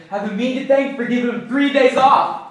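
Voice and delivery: rising into a loud, high shout; indignant outburst. Acted. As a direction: crow the bitter point at full voice. Indignant about staff's days off.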